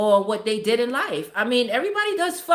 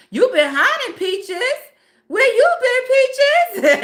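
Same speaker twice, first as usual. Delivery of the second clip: teasing squeal; 13 semitones higher, 7 dB louder, slower than usual. Real, not acted. Greeting a long-missing chat viewer, laughing high squeal. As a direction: stretch it out high and giggling, teasing her. Teasing Peaches who reappeared.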